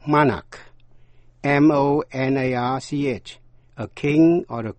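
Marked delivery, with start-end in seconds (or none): 0.1-0.6 "monarch" with an open "ah" first; stressed "ah" vowel in "monarch"; open A sound. Shown as a demonstration. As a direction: say "monarch" as MAH-nark. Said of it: "Phát âm là chữ A".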